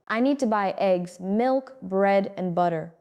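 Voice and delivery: shopping list tune ending down on "butter"; list intonation. Shown as a demonstration. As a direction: rise on "eggs", "milk", "bread", fall on "butter". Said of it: "up on each item and down on the last".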